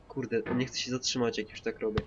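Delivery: ordinary delivery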